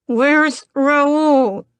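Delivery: high, loud call smothered by a gag; muffled through a gag. A narrator's reading. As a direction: shout it into a cloth over your mouth. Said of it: "I try to say".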